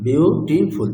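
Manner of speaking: Beautiful slowly split as বিউ, টি, ফুল; syllable by syllable. Shown as a demonstration. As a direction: draw out beautiful in three separate syllables. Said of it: "syllable কটা আছে?".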